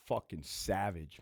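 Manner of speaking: ordinary talk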